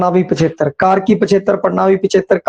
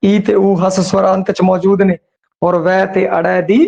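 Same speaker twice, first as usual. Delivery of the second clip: usual speaking voice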